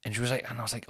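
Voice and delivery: usual speaking voice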